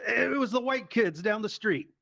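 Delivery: high-pitched, pleading mock alibi; blurted excuse. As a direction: blame someone else in a high voice. Drunk son's excuse, acted out.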